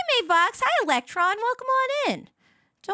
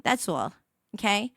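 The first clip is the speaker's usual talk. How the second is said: ordinary talk, nothing marked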